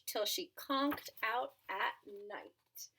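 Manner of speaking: ordinary delivery, nothing marked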